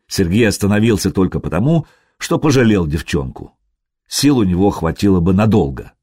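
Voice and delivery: ordinary delivery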